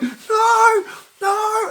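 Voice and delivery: very high, loud panicked no; boy running from a charging Doberman, high panicked scream. Retold or imitated. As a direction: scream in terror as the dog charges. Fleeing the attacking dog.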